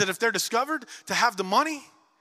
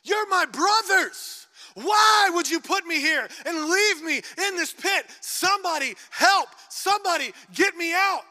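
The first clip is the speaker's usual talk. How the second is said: high, loud, anguished cries for help; desperate pleading; 12 semitones higher, 5 dB louder than usual. Retold or imitated. Joseph trapped in the pit begging his brothers, desperate cries. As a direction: beg your brothers from the bottom of the pit. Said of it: "He is pleading".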